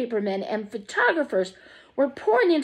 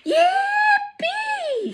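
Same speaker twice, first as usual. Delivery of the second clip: joyful shout. A narrator's reading. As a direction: whoop with pure joy. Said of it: "shouted Grandpa Joe".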